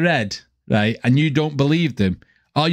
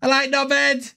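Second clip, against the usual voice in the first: higher-pitched Scouse impression; Scouse greeting; 7 semitones higher than usual. YouTuber echoing the Scouse cartoon, high Scouse accent. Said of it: "Straight away in Liverpool, you go".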